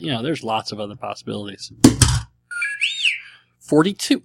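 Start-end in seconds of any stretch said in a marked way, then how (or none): 3.6-4.2 higher, rising in disbelief; exasperated. Real, not acted. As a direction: groan at the same number coming up. Same roll comes up again.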